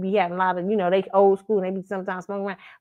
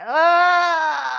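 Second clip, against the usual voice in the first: long, high, loud groan of dismay; mock horrified "ugh", drawn right out; disgusted groan; 5 semitones higher, 4 dB louder than usual. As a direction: let out a big revolted groan. Retold reaction to his smoking.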